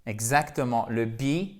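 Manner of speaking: usual speaking voice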